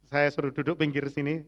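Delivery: ordinary delivery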